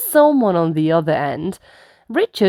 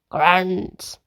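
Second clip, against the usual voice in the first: plain reading voice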